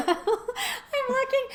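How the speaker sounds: high, loud, slow words through laughter; real laughter breaking the words; laughing. Real, not acted. Laughs retelling the burst pipe.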